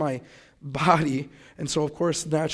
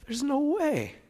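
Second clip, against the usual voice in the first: much higher, mock-disbelieving inner voice; incredulous; 10 semitones higher, slower than usual. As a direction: scoff at the idea in a high voice. Doubting the guy returns.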